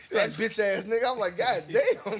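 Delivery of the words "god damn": laughing exclamation. Real, not acted. Laughing at the story.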